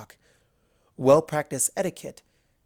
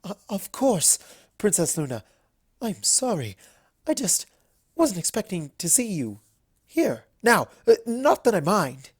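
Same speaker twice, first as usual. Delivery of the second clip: higher, flustered stammering apology; nervous fluster; 6 semitones higher, 4 dB louder than usual. A narrator's reading. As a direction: caught off guard and scrambling. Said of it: "She laughed nervously".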